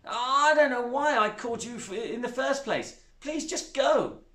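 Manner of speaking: put-on a grieving girl sending her stepmother away; upset girl. A narrator's reading. Zoe voiced in story.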